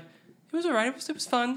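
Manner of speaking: high, strained fake-polite answer; awkward polite reply. Caught talking about the film to its actress, high forced politeness. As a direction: squeak out a forced compliment.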